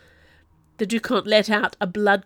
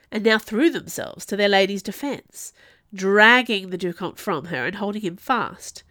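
ordinary delivery, nothing marked

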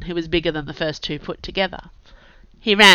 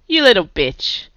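angry insult; 4 semitones higher, 6 dB louder than usual. A narrator's reading. Bruiser squeezing girl who hit him.

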